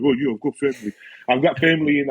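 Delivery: lightly imitated friend's voice; Talks impression. Repeating the mate's reply to show off the impression, lightly imitated. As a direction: put on your mate's easygoing reply. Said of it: "That's my talks, by the way".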